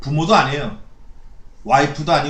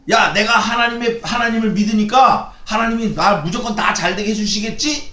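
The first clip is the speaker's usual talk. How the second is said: higher and louder, smugly self-assured; smug presumption; 7 semitones higher, 5 dB louder than usual. Retold or imitated. Preacher mimics complacent believers, louder smug boast. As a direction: brag like you're sure God owes you. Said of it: "이러고".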